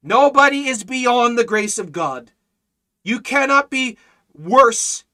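loud, emphatic gospel proclamation; full-force preaching. Real, not acted. Preacher assuring listeners of God's grace, full-force preaching. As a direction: drive each phrase home from the pulpit.

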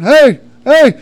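high, loud calls into a back room; imitated searching shout; hollering. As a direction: holler out to see who's home. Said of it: "I hollered".

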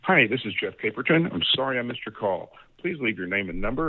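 ordinary talk, nothing marked

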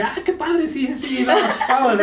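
very high, laughing mock excitement; happy dog. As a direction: voice the dog thrilled by the noise. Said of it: "el perro así como que".

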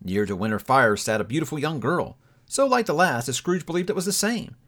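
ordinary delivery, nothing marked

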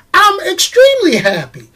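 "happy" sung out high and gleeful; high excited happy. Real, not acted. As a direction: chirp it out like it's payday.

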